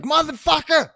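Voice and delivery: high, loud mock curse; retold yell. Cop cursing at the driver, loud retold yell. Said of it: "She was like".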